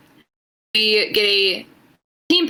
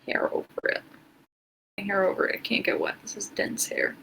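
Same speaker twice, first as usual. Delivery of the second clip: whispering; 10 dB quieter than usual. Real, not acted. Under spray, talking low.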